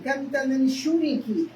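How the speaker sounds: usual speaking voice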